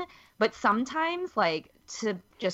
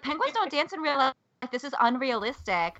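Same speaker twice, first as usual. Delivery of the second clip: higher-pitched, indignant protest; mock upset reviewer; 6 semitones higher than usual. Host imitating an upset one-star movie reviewer, mock indignation. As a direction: whine it like an offended moviegoer. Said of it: "was so upset that he's like".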